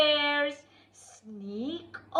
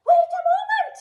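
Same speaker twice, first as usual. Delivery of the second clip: startled shout; 12 semitones higher, 6 dB louder, much faster than usual. Acted. Character suddenly objects.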